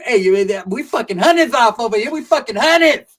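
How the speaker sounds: loud, high yell across the room; hyped yell with a strained high voice. Retold or imitated. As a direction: shout it over the noise of the raid. Friend yelling while looting house.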